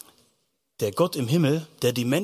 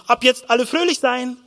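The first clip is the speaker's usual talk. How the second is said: high-pitched mock order; mock command; 6 semitones higher, 3 dB louder than usual. Preacher mocks ordering everyone to be happy, commanding. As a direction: issue a hammed-up decree to be cheerful. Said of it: "den Befehl aussprechen".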